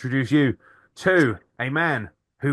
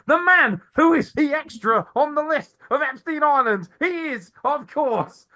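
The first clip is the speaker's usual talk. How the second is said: hammed-up announcer intro; 10 semitones higher than usual. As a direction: build up the guest like a ring announcer.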